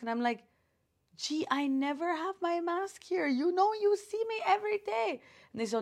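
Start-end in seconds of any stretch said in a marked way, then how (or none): 1.2-5.2 higher, put-on indignant plea; imitating her protest to police; mock plea. Pleading with police, retold.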